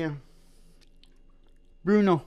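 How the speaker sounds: ordinary delivery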